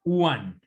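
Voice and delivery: One said as wan; One like U A N, no G at the end; English one. Shown as a demonstration. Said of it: "Es como U A N". (One como "uan", sin G)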